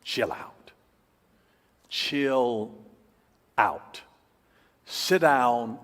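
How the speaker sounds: low, soft, slow soothing words; calm the listener right down with a hush; a gentle hushed God telling you to calm down. Pretend. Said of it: "he will say to you in essence".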